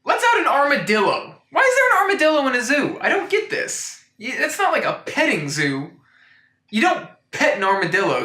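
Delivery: high, baffled outrage; laughing disbelief at an armadillo in a zoo; incredulous. Real, not acted. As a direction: pitch shoots up in disbelief, laughing. Baffled rant about armadillo.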